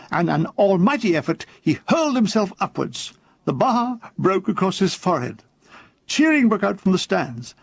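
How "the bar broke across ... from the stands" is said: usual speaking voice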